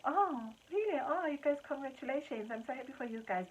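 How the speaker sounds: a hint of polite congratulations; polite surprise. Typical relatives giving average polite congratulations. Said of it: "they were like".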